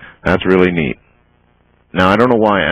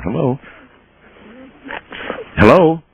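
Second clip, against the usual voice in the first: calling out hello; 10 semitones higher than usual. Real, not acted. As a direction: call out to a silent line. Caller not answering.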